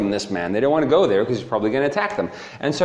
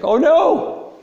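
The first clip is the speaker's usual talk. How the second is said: high-pitched comic dismay; mock panic; 13 semitones higher, 3 dB louder than usual. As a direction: squeal it like a monster suddenly scared. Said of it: "he's not that scary anymore".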